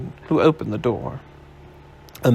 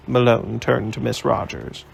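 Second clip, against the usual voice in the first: plain reading voice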